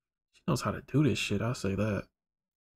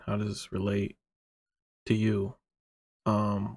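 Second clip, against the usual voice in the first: usual speaking voice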